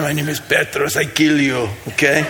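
lightly voiced Russian thug; Russian mobster. Joking about Petros as a Russian mobster, lightly accented. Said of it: "I mean".

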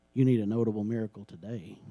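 ordinary talk

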